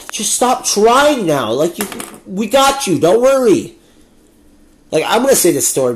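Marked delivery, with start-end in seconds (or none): 0.1-3.8 condescending reassurance. Real, not acted. Patronizing the boomers.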